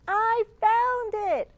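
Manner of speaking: high, loud, slow shout of discovery; thrilled child crying out; excited cry. Acted. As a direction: call it out like you just spotted treasure. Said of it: "I cry".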